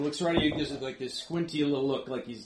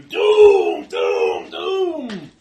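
creatures shouting; 10 semitones higher, 15 dB louder, much slower than usual. Acted. Little creatures closing in on the party, ominous shouts. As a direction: shout as several creatures in turn, each cry drawn out. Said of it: "They're all shouting at you".

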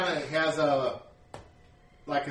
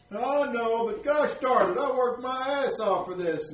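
high, louder complaining villager; grumbling farmer; 9 semitones higher, 5 dB louder than usual. Acted. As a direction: gripe about the hay. Clem complains back.

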